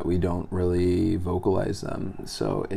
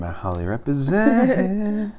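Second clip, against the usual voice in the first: high, slow, hyped-up cheer; hyped shout-out; 12 semitones higher, 5 dB louder than usual. Pretend. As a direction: rep the hometown with a high cheer. Hometown shout-out, echoed.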